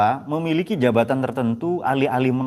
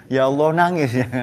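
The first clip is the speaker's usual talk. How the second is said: ordinary talk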